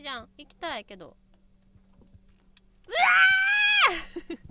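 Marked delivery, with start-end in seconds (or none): 2.9-4.4 scream. Real, not acted. Falling in the game.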